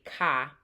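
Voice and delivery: "ka", car said with no R; Boston "ka" for car, R gone; dropped R. Shown as a demonstration. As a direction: end "ka" on an open ah. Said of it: "speakers often drop from the letter R".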